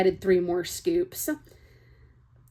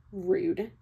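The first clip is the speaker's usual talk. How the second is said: ordinary delivery, nothing marked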